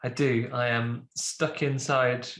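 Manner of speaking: ordinary talk, nothing marked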